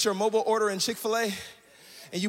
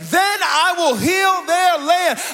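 rising, louder preaching with a sung lilt; full-force preaching; 6 semitones higher, 5 dB louder than usual. Acted. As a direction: preach it out to the room, building. Preacher building to full-force climax.

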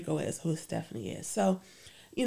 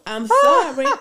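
playful loud apology; 9 semitones higher, 10 dB louder than usual. Real, not acted. Jokey apology amid laughter.

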